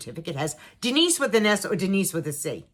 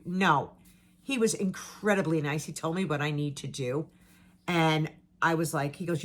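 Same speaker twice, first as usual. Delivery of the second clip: ordinary delivery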